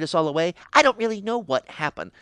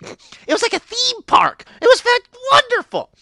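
breathless delight, pitched way up; gushing delight; 6 dB louder than usual. Retold or imitated. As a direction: swoon over how wonderful it was. Gushing about her garden.

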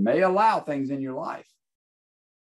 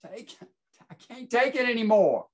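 high, strained cry of despair; a broken man begging for relief; anguished plea; 11 semitones higher than usual. Acted. Preacher voicing Paul begging God to remove the thorn, anguished and high.

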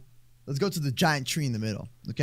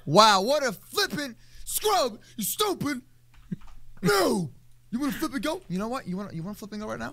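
high-pitched, squeaky taunting; mock tough talk; 8 semitones higher than usual. Player challenging whoever killed teammate, squeaky mock taunting.

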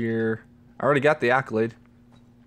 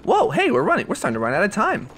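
high, hurried exclamation; startled, pitch jumping up then rushing; surprised; 6 semitones higher, 4 dB louder, faster than usual. Real, not acted. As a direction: blurt it out as time runs short. Surprised time is running out.

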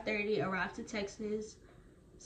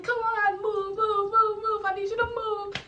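high, loud barking shout; mock instructor shout, much higher and louder; drill instructor yelling; 11 semitones higher, 7 dB louder than usual. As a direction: yell it like a drill sergeant hurrying recruits. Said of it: "people yelling".

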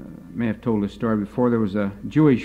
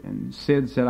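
usual speaking voice

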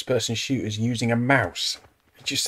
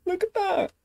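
squeaky delight. Real, not acted. Reacting to game scene.

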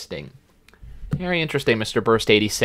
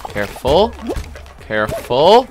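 drawn-out "careful", rising and swelling; building careful warning; 4 semitones higher, 5 dB louder, much slower than usual. Real, not acted. Gamer dodging griefers in a game, drawn-out rising warning. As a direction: stretch each careful longer and higher.

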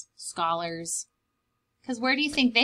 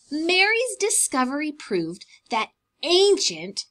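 ordinary delivery

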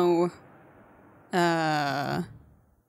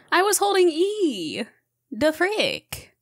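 high, slow disbelieving whine; high-pitched indignant whine; incredulous; 9 semitones higher, slower than usual. Real, not acted. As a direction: protest it like it's unfair. Frustrated disbelief at getting stuck.

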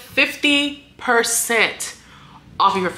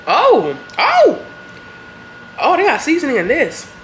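excited; 7 semitones higher, 6 dB louder than usual. Real, not acted. First bite, delighted reaction.

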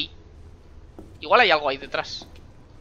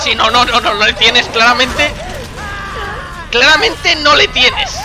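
raised, high contradiction; loud protest; 8 semitones higher, 8 dB louder, slower than usual. Real, not acted. Player contradicting a character losing their grip, loud protest. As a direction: shout it over the action, insistently.

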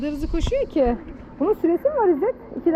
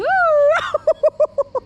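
loud delighted laugh; 10 semitones higher, 8 dB louder than usual. Real, not acted. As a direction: burst of loud high laughter. Laughing at running dog.

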